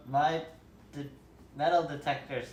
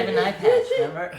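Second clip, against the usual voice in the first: laughing; 14 dB louder than usual. Real, not acted. Player laughing loudly.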